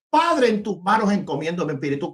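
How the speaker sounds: ordinary talk, nothing marked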